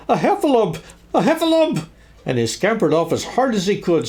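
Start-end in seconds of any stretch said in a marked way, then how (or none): 0.1-1.9 high-pitched squeal of alarm; panicked cry. A narrator's reading. Piglet warns of the monster he just saw, panicked squeal. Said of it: "cried Piglet".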